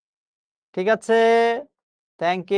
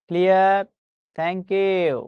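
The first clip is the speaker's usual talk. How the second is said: normal talking voice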